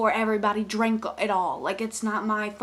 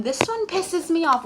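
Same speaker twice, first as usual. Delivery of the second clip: higher, louder self-parody; mocking self-quote; 7 semitones higher, 5 dB louder than usual. Vlogger parodying their own repeated complaint, self-mocking. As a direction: mimic your own repeated complaint in a raised voice. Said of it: "I know I keep saying".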